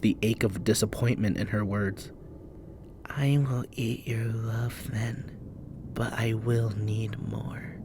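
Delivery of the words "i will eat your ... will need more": slow wheezing whisper. Acted. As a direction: wheeze like an ancient spirit. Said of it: "she wheezed at him".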